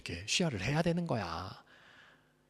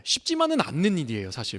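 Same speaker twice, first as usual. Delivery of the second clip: usual speaking voice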